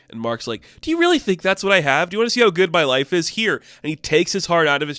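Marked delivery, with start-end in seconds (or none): 0.8-3.6 higher, louder, fast challenge; heated retort. Retold or imitated. Mark snapping back at his brother Randall, heated. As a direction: throw it back at him hotly.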